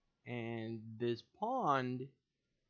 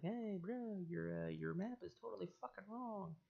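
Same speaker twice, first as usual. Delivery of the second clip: higher, softer mock plea; imitating pleading bro; 5 semitones higher, 5 dB quieter than usual. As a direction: beg him like a fellow fan. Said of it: "supposed to be like".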